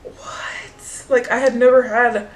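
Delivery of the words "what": a breathless, voiceless "what?"; stunned whisper. Retold or imitated. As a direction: whisper it in disbelief. Stunned by rude saleslady.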